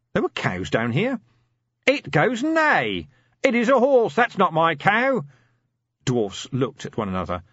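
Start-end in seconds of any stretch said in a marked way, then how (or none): 1.9-5.2 slow, high, loud nursery-book reading; reading the baby's book like a madman; high and loud, savouring the words; deranged sing-song. Acted.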